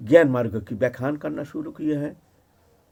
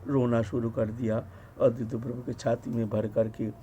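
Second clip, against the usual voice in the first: low, soft, subdued storytelling; tender, moved narration; 5 semitones lower, 5 dB quieter than usual. A narrator's reading. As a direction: tell the tearful reunion gently, with hushed devotion. Narrating a tender scene of weeping.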